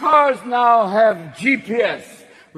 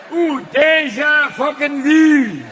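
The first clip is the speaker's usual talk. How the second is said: Scottish GPS; 5 semitones higher than usual. Retold or imitated. The honest Scottish GPS cursing the driver, Scottish accent. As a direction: berate him in a Scottish accent. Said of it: "I want a Scottish G P S".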